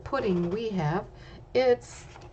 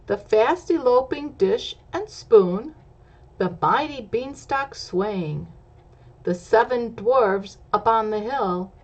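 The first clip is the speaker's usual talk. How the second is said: louder, higher sing-song reading; lively picture-book rhyme; story narration; 3 semitones higher, 6 dB louder than usual. A narrator's reading. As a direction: read the rhyme with bounce for kids. Reads rhyming story text aloud.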